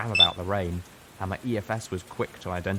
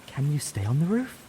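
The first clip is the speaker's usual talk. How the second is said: higher, worried mare's voice; concerned; 7 semitones higher than usual. A narrator's reading. Velvet asks Steelhooves, worried, in a higher voice. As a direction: fret over whether he can hold on. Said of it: "Velvet asked, concerned".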